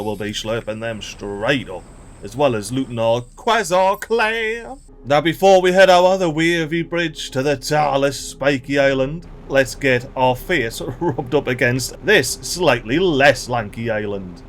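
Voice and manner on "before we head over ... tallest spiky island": ordinary talk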